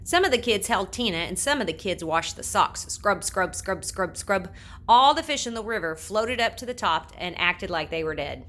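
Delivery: plain reading voice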